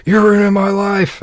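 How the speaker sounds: hammed-up upset whine; whiny mock sob. Drunk kid upset again at the jail, mock whining. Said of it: "he starts getting all upset again".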